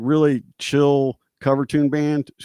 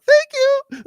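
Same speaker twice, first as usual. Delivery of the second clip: much higher, louder starstruck squeak; squeaky thank you; 6 dB louder than usual. Retold or imitated. Guitarist thanking the big dude, squeaky high gush. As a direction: gush it like a thrilled fan. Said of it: "trying not to do a voice like".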